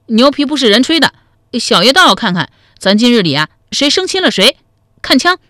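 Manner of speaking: put-on high, loud child's retort; childish bravado, pitched high; scornful little boy. A narrator's reading. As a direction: scoff back as a cocky small kid. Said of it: "冷哼一声说".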